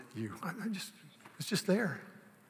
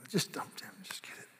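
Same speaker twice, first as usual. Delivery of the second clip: whisper; 14 dB quieter than usual. Real, not acted. Quick aside to someone nearby.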